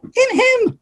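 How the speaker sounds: high-pitched prim throat-clear; put-on witch's interjection; witch in pink. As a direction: squeak the two syllables, second held high. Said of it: "that ugly him".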